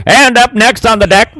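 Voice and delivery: booming vintage ballpark announcer; old radio announcer. Pretend. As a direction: announce it like a 1940s radio host. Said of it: "make me sound like an old radio announcer".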